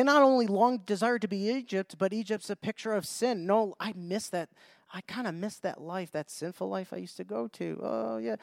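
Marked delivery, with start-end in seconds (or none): 3.4-8.4 wistful sinner. Retold or imitated. Voicing people longing for sin.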